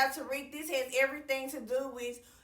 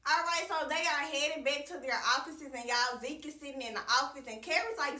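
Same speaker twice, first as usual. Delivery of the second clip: ordinary delivery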